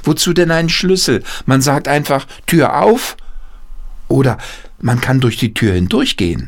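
boy Peter, lightly voiced. Peter telling his father about walking through doors, lightly boyish. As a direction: lightly play the clever little boy explaining.

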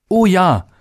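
put-on high, eager call of a child character; eager cry. A narrator's reading. Little day answering his father, eager high cry. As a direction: answer brightly, pitched well up. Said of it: "rief der kleine Tag".

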